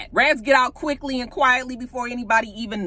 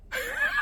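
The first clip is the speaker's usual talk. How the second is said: high laugh; 8 dB quieter than usual. Real, not acted. Laughing at scene.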